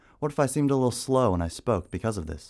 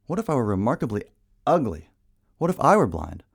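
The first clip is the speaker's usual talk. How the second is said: ordinary talk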